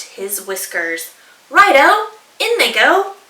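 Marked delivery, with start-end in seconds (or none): 1.5-3.2 much higher, louder cheerful; eager dingo. Acted. Dingo adding gum nuts, high and loud. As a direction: toss the gum nuts in gleefully.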